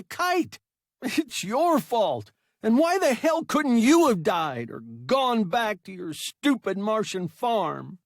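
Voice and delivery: high, loud angry crying rant. A narrator's reading. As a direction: sob and rage at once. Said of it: "The boy was crying".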